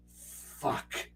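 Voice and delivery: breathless defeated whisper; whispered curse. Retold or imitated. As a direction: mutter it under your breath in dismay. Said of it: "opens it up and goes".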